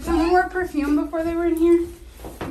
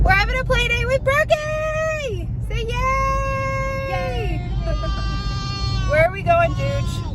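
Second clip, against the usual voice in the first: excited mom; 10 semitones higher than usual. Real, not acted. Mom cheers to her toddler.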